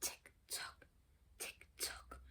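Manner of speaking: whispered tick-tock; ticking clock. Acted. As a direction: tick softly like a clock in the night. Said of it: "went the living room clock".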